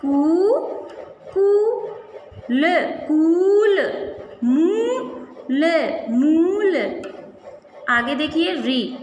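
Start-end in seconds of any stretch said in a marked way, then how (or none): 0.0-2.6 कूल stretched very slowly, syllable by syllable; teacher sounding out कूल slowly. Shown as a demonstration. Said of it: "क में बड़े ऊ की मात्रा". 4.4-5.6 मूल stretched very slowly; teacher sounding out मूल slowly. Shown as a demonstration. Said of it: "बड़े ऊ की मात्रा".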